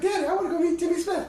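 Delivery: put-on high, eager child voice, quickly; little boy. Small son asking his dad to meet a musician, high child voice. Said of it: "little Mark".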